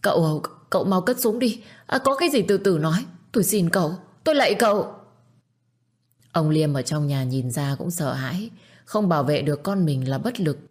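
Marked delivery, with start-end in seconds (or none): 0.0-4.9 high, panicky pleading; frantic begging. A narrator's reading. Aunt begs the gunman for mercy, frantic begging. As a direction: beg for your life. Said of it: "sau đó lạy Hưng rối rít".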